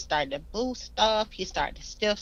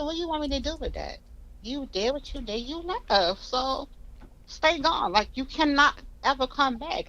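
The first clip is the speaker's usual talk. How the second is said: irritated; 9 semitones higher than usual. Retold or imitated. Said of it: "'Cause I'm irritated at this moment".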